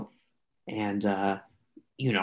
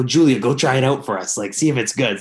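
louder, eager urging; excited urging; 3 semitones higher, 6 dB louder than usual. Retold or imitated. Urging Julia to try the leftover food, excited. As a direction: egg her on excitedly. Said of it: "I go".

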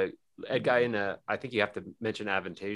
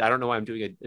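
ordinary talk, nothing marked

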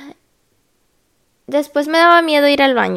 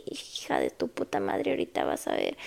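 menacing threat; 14 dB quieter than usual. Retold or imitated. Threatening the nurse in pain.

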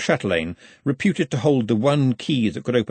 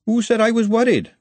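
loud, high, indignant retort; sharp, offended challenge; snapped; 12 semitones higher, 5 dB louder than usual. A narrator's reading. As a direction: snap back defensively. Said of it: "Glenda snapped".

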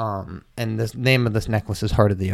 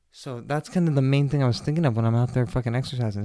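usual speaking voice

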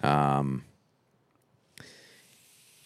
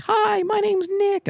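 much higher, slow, thin little voice; pathetic high voice; 4 dB louder, slower than usual. Shown as a demonstration. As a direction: sound small and pathetic. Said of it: "and I sound, like, pathetic".

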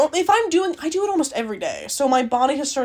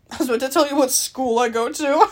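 ordinary talk

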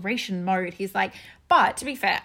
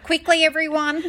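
1 imitating urgent Australian; 7 semitones higher, 7 dB louder than usual. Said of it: "That is so Australian".

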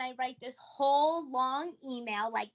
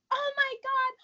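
much higher, fast, thrilled squeal; giddy mom; 11 semitones higher than usual. Retold or imitated. Mom to daughter about the show, giddy and high. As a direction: play the overjoyed mom.

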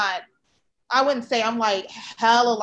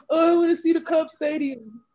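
higher, louder mock fan voice, laughing; coworker voice; 6 semitones higher, 4 dB louder than usual. Teller voices his eager baseball-fan coworker, laughing. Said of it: "he was just like".

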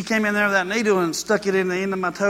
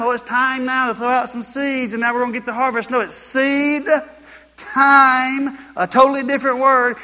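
raised, insistent pulpit preaching; 6 semitones higher, 5 dB louder than usual. Acted. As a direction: drive it home to the congregation. Preaching at full force.

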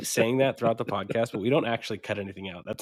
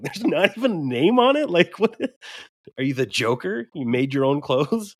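incredulous; 5 semitones higher, 6 dB louder than usual. Retold or imitated. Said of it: "I'm like".

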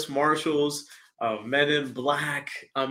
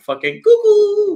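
mock excited cry; 8 dB louder than usual. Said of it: "we've all would have been like".

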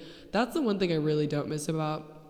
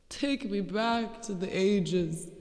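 slow, higher-pitched theatrical plea; dramatic lament; 4 semitones higher, much slower than usual. Pretend. Podcaster lamenting abysmal flirting, drawn-out theatrical plea. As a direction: wail it slowly in mock despair.

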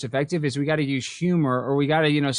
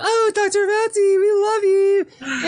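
high, slow, gushing mock adoration; sarcastic imitation of adoring fans, much higher and louder; mock fawning fan; 4 dB louder, much slower than usual. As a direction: swoon over him like a lovestruck fan, hammed up. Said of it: "they're like".

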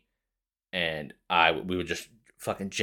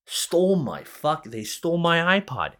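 higher, louder outraged retelling; indignant excited reveal; 10 semitones higher, 6 dB louder than usual. Real, not acted. Telling listeners his friends stole it, higher and louder. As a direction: blurt out the betrayal.

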